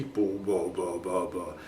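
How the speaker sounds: low, boomy mic-too-close sound; bassy boom. Shown as a demonstration. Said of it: "it makes it very bassy".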